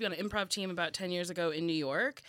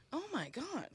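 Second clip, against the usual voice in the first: high, soft mock revelation; hushed awe; 7 semitones higher, 6 dB quieter than usual. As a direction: breathe it out like a sudden epiphany. Retells her awed realization.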